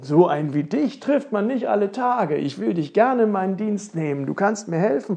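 lively, impressed prince; amazed. Acted. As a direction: marvel out loud at your new companion. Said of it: "staunte der Prinz nun".

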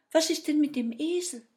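high, quick, loud question; fairy voice. A narrator's reading. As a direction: question him briskly as the fairy. (hohe, rasche Feenstimme)

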